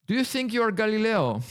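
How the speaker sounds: put-on shouted high in an Italian accent. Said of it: "in an Italian accent".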